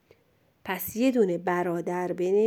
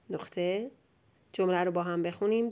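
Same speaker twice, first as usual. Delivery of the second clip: ordinary delivery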